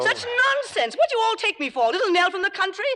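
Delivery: very high, scornful indignation; indignant outburst. Acted. Star refusing to be fooled about her understudy, indignant outburst. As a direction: explode in high-pitched outrage.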